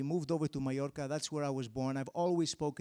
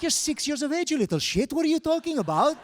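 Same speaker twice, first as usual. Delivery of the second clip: mock outrage; 10 semitones higher, 8 dB louder than usual. Retorting to cheeky kid.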